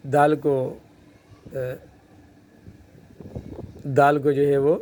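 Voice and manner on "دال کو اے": ordinary delivery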